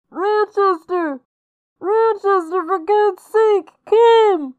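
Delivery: very high, loud, drawn-out cries; desperate screamed call. A narrator's reading. Victim calling Rochester through plank and plaster, desperate scream. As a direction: scream for him to come, stretching the words. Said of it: "it cried, and then".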